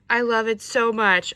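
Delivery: loud, emphatic delight; loud burst of love; emphatic gush. Real, not acted. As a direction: belt out your adoration. Repeats the gush more forcefully.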